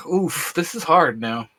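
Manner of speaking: usual speaking voice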